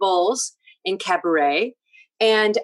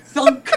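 mock thunk; 6 semitones higher, 6 dB louder than usual. Imitating the dresser's move.

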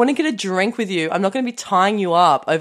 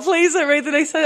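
pleading; 8 semitones higher than usual. Real, not acted. Begging host to stop reading.

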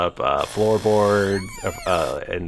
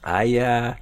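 high, fast and eager; eager weasel voice; 7 semitones higher, faster than usual. Acted. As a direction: pitch up, rush the offer. Dragonborn cellmate talks.

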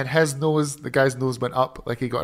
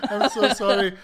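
sorry through laughter; high apologetic laughing voice; laughing apology; 6 semitones higher than usual. Real, not acted.

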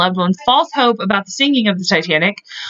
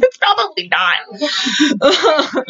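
slow, deadpan, drawn-out words; dry sarcastic reply; 6 semitones higher, much slower than usual. Pretend. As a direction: let the doubt hang on every word. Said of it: "And I'm like".

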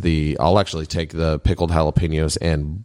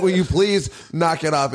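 exasperated plea; 10 semitones higher than usual. Retold or imitated. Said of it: "I was like".

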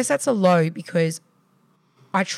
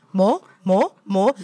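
put-on higher, louder toddler chant; demanding toddler; 3 semitones higher, 6 dB louder, slower than usual. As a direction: mimic a greedy little kid. Toddler demanding more food.

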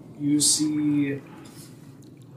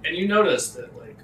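normal talking voice

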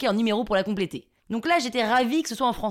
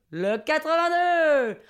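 high, slow hall announcement; bingo caller; 4 semitones higher, much slower than usual. Retold or imitated. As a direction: draw the number out for the whole hall. Monsieur Loyal announces the number. (annonce traînante de monsieur Loyal)

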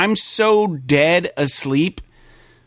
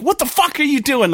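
high-pitched mock yell, jolted awake; half-acted startled scream at the wife; retold scream; 11 semitones higher than usual. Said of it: "I just go scream".

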